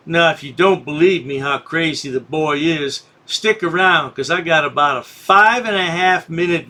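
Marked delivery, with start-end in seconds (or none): none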